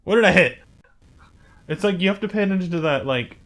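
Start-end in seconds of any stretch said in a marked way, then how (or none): 0.0-0.6 louder, higher baffled question; exasperated demand. Real, not acted. Gamer still wondering about the crash, loudly baffled. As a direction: repeat it loudly in disbelief.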